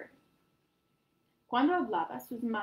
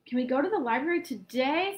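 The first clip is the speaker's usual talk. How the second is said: begging; 4 semitones higher, 6 dB louder than usual. A narrator's reading. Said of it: "Santiago begged".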